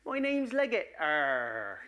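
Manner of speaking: high hammed-up West Country arr; Wessex farmer accent. Ancestor tells the registrar his name, hammed-up Wessex accent. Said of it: "he's gone".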